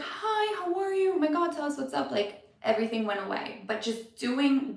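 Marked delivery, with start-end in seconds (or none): 0.0-2.1 high, sing-song mock of excited agency staff; gushing greeting. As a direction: chirp it like overeager coworkers. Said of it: "and everybody was like".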